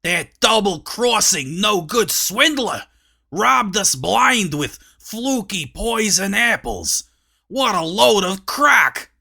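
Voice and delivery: fuming at being cheated; angry rant. A narrator's reading. Dwarf ranting about the poisoned apples, angry.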